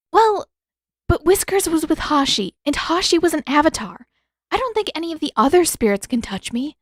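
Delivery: put-on young heroine voice, heated; Katara, insistent. A narrator's reading. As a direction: argue urgently with Zuko.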